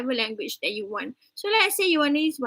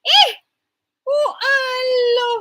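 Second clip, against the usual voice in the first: shocked cry; 12 semitones higher, 7 dB louder, much slower than usual. Acted. Mock horror at prayer robes.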